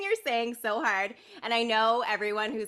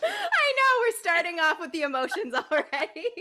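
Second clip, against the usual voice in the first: laughing; 9 semitones higher than usual. Real, not acted. Host laughing at getting emotional.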